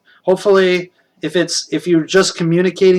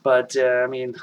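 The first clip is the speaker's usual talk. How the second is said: ordinary talk, nothing marked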